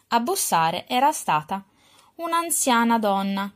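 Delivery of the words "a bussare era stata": usual speaking voice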